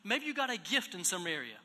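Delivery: normal talking voice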